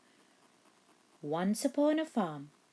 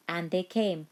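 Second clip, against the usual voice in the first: ordinary delivery, nothing marked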